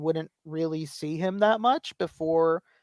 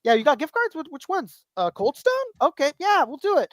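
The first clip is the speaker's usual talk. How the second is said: put-on chatty kid; 8 semitones higher, 3 dB louder than usual. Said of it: "Rick Dom as a kid".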